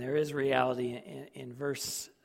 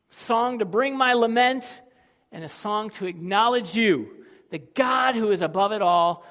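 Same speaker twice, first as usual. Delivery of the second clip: loud, high, urgent preaching; rising fervent sermon voice; impassioned preaching; 6 semitones higher, 9 dB louder than usual. Acted. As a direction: preach it with fire. Preaching at full force.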